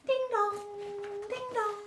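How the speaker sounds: sung high like a doorbell chime; high sung ding dong doorbell. A narrator's reading. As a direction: chime it high, two notes. Doorbell rings in story.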